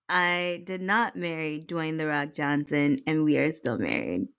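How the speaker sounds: low late-night radio host voice; Delilah. Pretend. Wife reassuring her husband on air, low radio-host voice. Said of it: "that was my Delilah voice".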